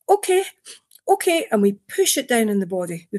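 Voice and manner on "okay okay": anxious inner voice. Retold or imitated. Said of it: "that goes".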